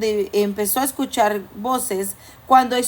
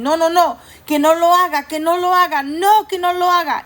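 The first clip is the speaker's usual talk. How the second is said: higher, louder pleading no, no, no; a lightly voiced anxious inner voice crying no; voice of fear; 6 semitones higher, 4 dB louder than usual. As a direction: play the scared inner voice begging her to stop. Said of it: "ellos hablan y dicen".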